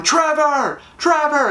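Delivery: high, calling name across the outfield; fans calling out. Retold or imitated. Fans in the stands calling the outfielder, high calling shout. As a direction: shout to get his attention.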